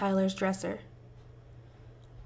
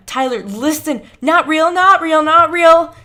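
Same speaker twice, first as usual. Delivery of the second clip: frantic shouting; 8 semitones higher, 16 dB louder than usual. Acted. Escalating shouting match.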